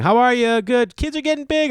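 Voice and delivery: very high, sing-out pleasantries; exaggerated polite neighbor voice; mock small talk. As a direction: chirp empty neighborly niceties. Retold small talk between neighbours.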